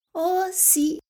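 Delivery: put-on high child's voice; innocent little girl. A narrator's reading. Little Red answering the wolf, innocent girlish voice. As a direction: agree innocently, like a small girl.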